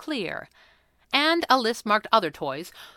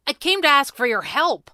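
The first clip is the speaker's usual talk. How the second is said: high, loud indignant protest; outraged; 7 semitones higher, 6 dB louder than usual. A narrator's reading. Brother objects to his sister charging him, outraged. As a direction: protest the betrayal, voice raised and pitched up.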